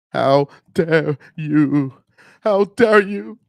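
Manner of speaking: drawn-out, indignant scolding; high mock-outraged activist impression; Greta Thunberg. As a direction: stretch each word in accusing outrage. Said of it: "Got my Greta Thunberg voice".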